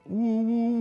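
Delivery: high, drawn-out fake sobbing; playful boo-hoo wail; mock wailing "woo".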